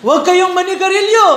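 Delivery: loud, high preachy yell at the youth; mock-stern hollering at teenagers; scolding shout. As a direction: bark orders at the kids like a hypocrite teacher. Teacher scolds youth, mock hypocrite.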